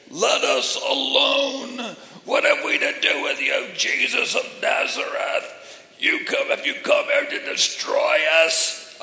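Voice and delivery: high, rasping drawn-out demon shout; demon crying out. Acted. Demon confronting Jesus in the synagogue, loud tormented cry. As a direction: cry out slowly in a strained, unearthly voice. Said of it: "he cried out with a loud voice".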